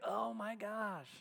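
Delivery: slow, drawn-out mock groan of "Oh my gosh"; grumbling exasperation. Preacher mimicking a grumbler in the desert, slow exasperated groan. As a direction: sigh it out like a fed-up complainer. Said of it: "Do you grumble? Do you complain?".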